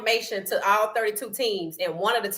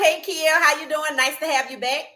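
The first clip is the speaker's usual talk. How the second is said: ordinary talk, nothing marked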